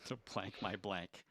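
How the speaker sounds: soft whisper, slow. Shown as a demonstration. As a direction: breathe it out low. Host whispers blanks.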